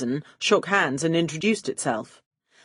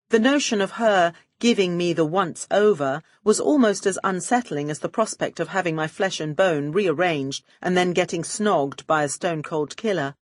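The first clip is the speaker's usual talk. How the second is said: ordinary delivery, nothing marked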